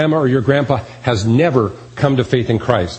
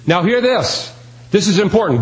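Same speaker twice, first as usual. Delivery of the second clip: a lightly voiced booming call from above, pitched higher; proclaiming from heaven, a touch raised; divine voice from heaven; 7 semitones higher than usual. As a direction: announce it like God calling from heaven. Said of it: "a divine voice from heaven saying".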